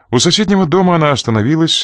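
usual speaking voice

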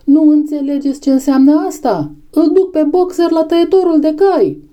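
high, desperate warning cry; desperate outcry. A narrator's reading. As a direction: plead with them to understand, voice high. Benjamin desperately warns the animals. (strigăt disperat, pe ton înalt)

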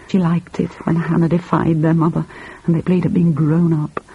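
usual speaking voice

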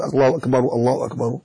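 low, soft, unchanted الله أكبر said straight through; run-on takbir. Shown as a demonstration. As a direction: run both أكبر phrases together quietly. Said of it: "هكذا، لا تقل".

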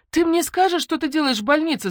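high, loud exasperated demand; impatient yell. A narrator's reading. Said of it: "заорала я".

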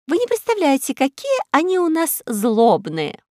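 put-on high old woman's lament about the tyrants; old lady. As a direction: lament like a kindly granny. Kind old woman complains.

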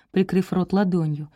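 ordinary delivery, nothing marked

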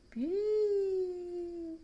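high piping whistle sound; thin high whistling squeak. A narrator's reading. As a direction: whistle it like the wind through a reed. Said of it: "zufolò".

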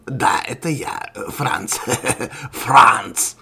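slow reveal breaking into a chuckle; chuckle, then boom out the name; gloating laugh ending in a loud flourish; laughing villain. Acted. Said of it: "со смехом ответил Ворон".

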